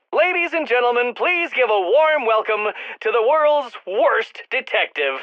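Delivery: high, grand mocking introduction; mock announcer. Villain presenting Batman to a crowd, mock announcer.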